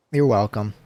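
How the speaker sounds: ordinary delivery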